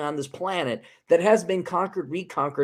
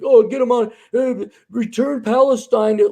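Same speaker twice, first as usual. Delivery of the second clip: higher, louder sarcastic parody; mocking whine; 5 semitones higher, 7 dB louder than usual. Ridiculing the return-Palestine argument with high mock outrage. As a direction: sneer out the demand like a nagging crowd. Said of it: "it is like".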